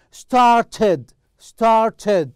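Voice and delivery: "Started" said high and loud, both syllables sounded out; pronunciation model. Shown as a demonstration. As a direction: drill "Started" with a distinct -ed syllable. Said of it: "How to pronounce well".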